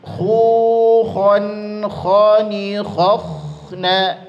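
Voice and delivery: "Khu" high and loud, the rest flowing; drilled syllables. Shown as a demonstration. Teacher drilling kh syllables with students. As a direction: model each syllable clearly.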